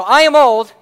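loud, high-pitched mock declaration; mock proclamation. Husband sits back and announces his age, loud mock proclamation. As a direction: proclaim it far too boldly.

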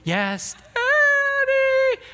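put-on very high, slow, whimpering little girl; little sad voice. Pastor voicing his sent-away little daughter, tiny sad whimper. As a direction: squeak it out like a sorry small child on the verge of tears. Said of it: "you'll hear this little sad voice".

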